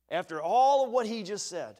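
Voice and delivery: ordinary talk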